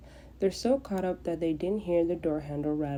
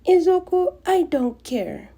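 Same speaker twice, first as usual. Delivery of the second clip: louder and higher, slowed, each word stamped; defiant retort; 12 semitones higher, 9 dB louder than usual. Acted. Bakugo snapping back at Izuku.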